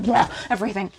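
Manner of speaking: mock spirit voice, blurting; lightly voiced Spirit spilling everything; voicing Spirit. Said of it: "Spirit's being like".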